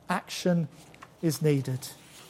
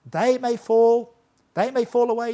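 boastful; 6 semitones higher, 5 dB louder than usual. Retold or imitated. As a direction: puff up and crow it over the others. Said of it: "He's so boastful".

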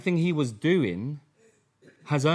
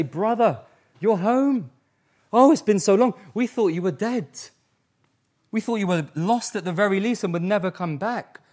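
high, loud and overjoyed; joyful welcome; 6 semitones higher, 4 dB louder than usual. Pretend. Preacher voicing son welcoming brother home, overjoyed. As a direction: greet the lost brother with delight.

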